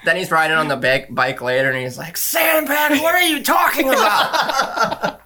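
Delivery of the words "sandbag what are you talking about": high, drawn-out shout with laughter; mock incredulous yell. Co-host quotes man riding on the bike, shouting. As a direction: holler it in exaggerated disbelief. Quoting character yelling from bike.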